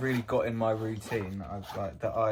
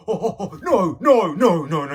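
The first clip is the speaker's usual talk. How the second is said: mock alarmed friend; 6 semitones higher, 7 dB louder than usual. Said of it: "They go".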